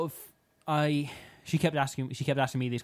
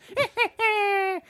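very high, drawn-out villain's cackle; witch cackle; 3 dB louder than usual. Pretend. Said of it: "like, a cackly witch voice".

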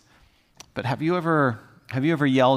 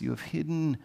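shifting to tears; 8 dB quieter than usual. Shown as a demonstration. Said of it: "to tears".

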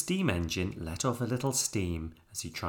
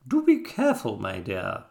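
fussy warning; 6 dB louder than usual. A narrator's reading. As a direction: warn a reckless friend grandly. Sally warns bouncing Jack.

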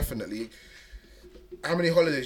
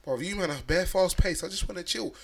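animated rant; 5 semitones higher, 4 dB louder than usual. Real, not acted. Mocking lazy holiday friends.

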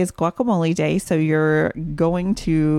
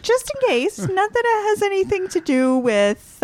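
laughing; 14 semitones higher than usual. Real, not acted. Sheepish dodge, laughing.

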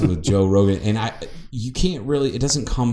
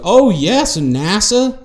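much higher, louder put-on voice; Carol; 12 semitones higher, 5 dB louder, slower than usual. Host imitating an office coworker's funny remark, high mock voice. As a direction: mimic her in a high, drawn-out lilt. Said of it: "Carol goes".

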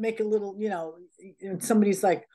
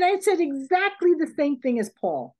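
retold protest; 6 semitones higher than usual.

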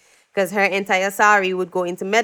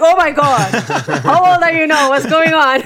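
loud, high, laughing reunion; laughing delighted surprise; 7 semitones higher, 7 dB louder than usual. Retold or imitated. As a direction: laugh with delight at grown students. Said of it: "I'm like".

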